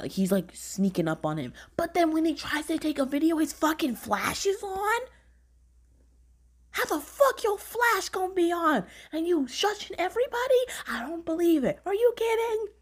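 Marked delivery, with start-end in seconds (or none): 1.8-12.7 high-pitched incredulous rant; exasperated disbelief. Real, not acted. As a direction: scold the character in squeaky outrage. Ranting at a character's stupid mistake.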